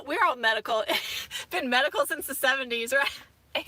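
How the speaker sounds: real giggly laughter; giggling. Real, not acted. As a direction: giggle it out between words. Giggling about being medical.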